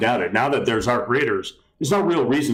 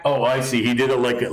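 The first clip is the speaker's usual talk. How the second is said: ordinary talk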